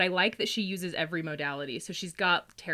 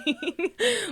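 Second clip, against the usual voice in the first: high squealing laugh; real laugh; 14 semitones higher, 4 dB louder than usual. Real, not acted. Laughing at the liar joke, high squeal. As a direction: burst out laughing.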